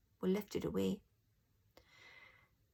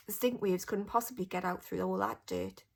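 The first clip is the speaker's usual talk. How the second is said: ordinary delivery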